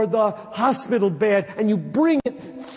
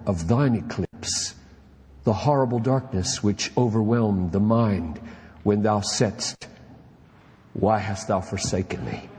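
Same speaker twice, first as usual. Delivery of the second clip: solemn reading; 11 semitones lower than usual. Acted. Preacher reading Newton's diary to pastors, low and reverent. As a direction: read it like a hushed devotion.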